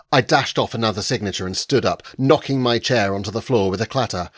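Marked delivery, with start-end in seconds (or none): none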